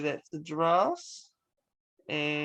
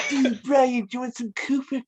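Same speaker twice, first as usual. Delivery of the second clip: imitating a British accent, pitched up; mock British accent; 6 semitones higher than usual. Interviewer mimics interviewee's attempted British accent, higher mock British accent. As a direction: playfully parody someone's British accent. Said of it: "it sounded like".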